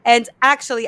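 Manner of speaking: normal talking voice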